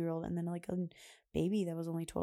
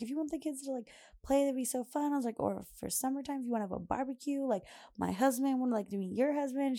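eager invitation; 6 semitones higher, faster than usual. Retold or imitated. Eager invitation to new mom.

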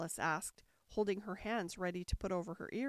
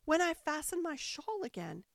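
mildly pained, higher-pitched queen; groaning; 6 semitones higher than usual. A narrator's reading. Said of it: "the poor queen groaned out".

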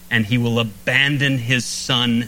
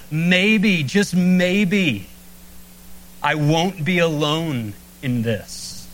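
higher, slower, a lifted hopeful voice that settles at the end; hopeful musing; 4 semitones higher, much slower than usual. Retold or imitated. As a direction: let the hope rise on the maybes, then ease off. Jesus' imagined hopeful thought.

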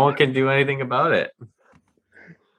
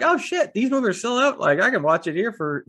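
excited; 9 semitones higher than usual. Retold or imitated. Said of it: "I was like".

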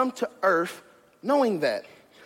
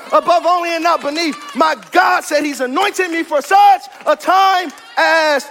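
fired-up preaching; 3 semitones higher, 5 dB louder than usual. Real, not acted. Preacher declaring what his God can do, fired-up preaching. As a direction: preach it in driving, mounting declarations. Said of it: "I feel like preaching this morning".

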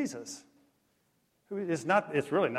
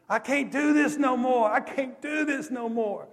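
high, loud desperate wail; screaming; 11 semitones higher, 6 dB louder than usual. Retold or imitated. Preacher retelling a sobbing camper's breakdown, screamed. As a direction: cry out as a boy at breaking point. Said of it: "he kept screaming".

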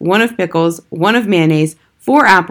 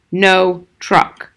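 curt, hard refusal; bullet-like. A narrator's reading. Father refusing his son without turning, curt. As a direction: fire the words off like bullets. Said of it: "The words came out like bullets".

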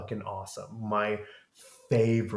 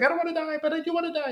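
high mock whine; hammed-up high whining Louis; whiny Louis; much faster than usual. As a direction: squeak it out like a moping teen. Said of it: "he's like".

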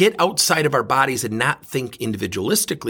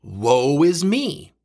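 very slow, drawn-out hammed-up wail; mock self-pity; much slower than usual. Shown as a demonstration. Mocking how we pity ourselves, slow hammed-up moan. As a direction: stretch it out like a sorry martyr. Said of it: "We tend to think about things that protect ourselves".

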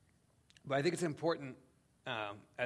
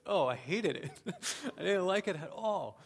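laughing admission; 7 semitones higher, 6 dB louder than usual. Retold or imitated. Retelling his reply to friend.

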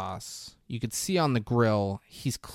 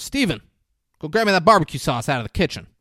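raised, higher voice, rattled off fast; calling out; 6 semitones higher, 7 dB louder, faster than usual. Retold or imitated. Dad sends Steven to the kitchen, calling out. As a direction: imitate a dad hollering an order. Dad orders son to fetch sauce.

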